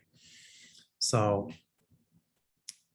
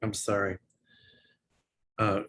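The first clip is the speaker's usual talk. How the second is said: usual speaking voice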